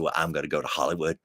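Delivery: fast, rising mock Eunice rant; Eunice's rant. Daughter telling family she'll be a movie star, building rant. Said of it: "and she said".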